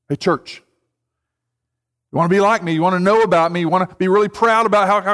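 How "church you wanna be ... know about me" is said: ordinary talk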